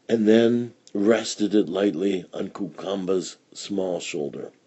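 ordinary delivery

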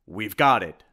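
loud triumphant call; loud, proud triumph. Acted. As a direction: announce the victory proudly. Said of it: "said Simon triumphantly".

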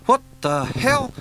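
high, loud, slow bewildered outburst; waking up baffled; confused shock. Acted.

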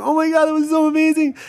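high-pitched imitation of an overwhelmed sister; tearful gushing sister. Sister hugging the comic after his set, imitated tearful gushing. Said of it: "She's crying".

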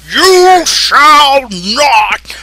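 very slow, loud, booming wizard command; Gandalf. Retold or imitated. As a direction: thunder it like a wizard blocking a bridge. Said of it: "I do have a Gandalf, uh, voiceover".

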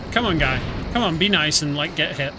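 ordinary talk

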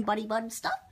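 ordinary delivery

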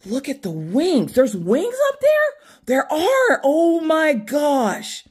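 high, drawn-out oh my gosh; thrilled to spot the wings; delighted surprise. Real, not acted.

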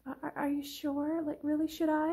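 soft, stammering mock uncertainty; timid doubt. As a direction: meekly beg for reassurance, hammed up. Indecisive girl doubts breakfast advice.

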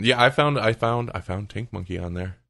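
ordinary talk, nothing marked

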